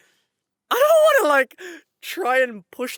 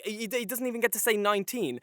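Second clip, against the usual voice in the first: normal talking voice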